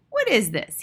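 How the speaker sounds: put-on indignant boyish query; bothered boy. A narrator's reading. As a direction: demand an explanation like a kid. Uneasy boy questions the witch.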